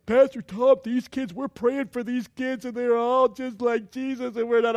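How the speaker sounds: high, loud imitation of pleading girls; pleading youth-group girls. Voices face-painting girls pleading with pastor.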